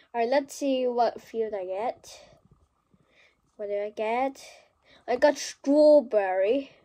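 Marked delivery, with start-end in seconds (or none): 5.1-6.7 slow, louder mock British accent. Gamer kid announcing his field in mock British accent. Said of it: "That was so British".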